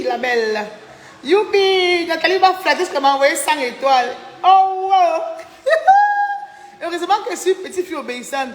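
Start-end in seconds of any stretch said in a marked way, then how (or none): 1.2-5.2 high, loud, jubilant cheer; gleeful excitement. Real, not acted. Celebrates receiving a hundred stars. 5.7-6.5 very high, loud gleeful shriek; delighted squeal. Real, not acted. Celebrates receiving a hundred stars.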